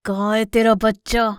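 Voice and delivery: loud villain's demand; menacing villain lion. Acted. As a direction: demand it like a threatening beast. Villain lion interrogates the mother. (खलनायक शेर की ज़ोरदार, धमकाती आवाज़)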